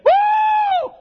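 very high, loud preacher's "woo"; piercing shout of jubilation; exultant whoop. Acted. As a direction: let out a revival-meeting whoop. Preacher whoops before shouted verdict.

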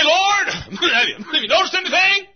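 high, loud, boastful cry to God; proud sinner. Acted. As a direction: shout your good deeds up to the Lord.

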